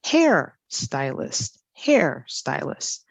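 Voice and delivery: Hair stylist with HAIR stressed and slow; stress on first word. Shown as a demonstration. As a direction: slowly, lift and hit Hair, let stylist fall. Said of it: "Same thing here".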